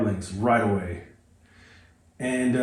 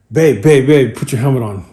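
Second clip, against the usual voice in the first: loud, urgent call to babe; 7 dB louder than usual. Retold or imitated. Husband hurries partner to get helmet on, urgent. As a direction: raise your voice, hurrying them along.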